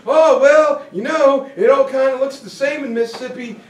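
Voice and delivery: high, loud, fast dopey excuse; clueless Wallace. Retold or imitated. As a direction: play it dumb and breezy.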